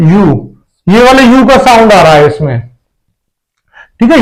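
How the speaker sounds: ordinary talk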